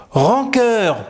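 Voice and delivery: ordinary talk, nothing marked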